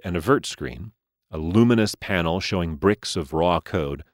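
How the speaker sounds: ordinary delivery, nothing marked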